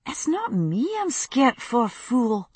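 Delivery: high, muttered Scots retort; muttering Scottish girl. Acted. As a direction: grumble it in a Scots lass voice. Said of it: "she muttered".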